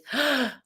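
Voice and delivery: sudden audible in-breath; sharp gasp. Real, not acted. Host suddenly recalling her dream, gasping. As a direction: gasp as a memory lands.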